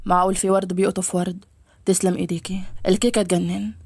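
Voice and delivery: low male character voice, complimenting her. Acted. Voices the male lead flirting. (صوت شاب منخفض يغازل)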